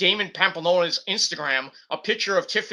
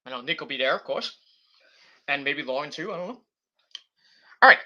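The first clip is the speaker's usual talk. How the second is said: normal talking voice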